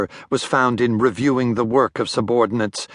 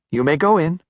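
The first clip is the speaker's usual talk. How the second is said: receptionist; 6 semitones higher than usual. A narrator's reading. Receptionist voiced.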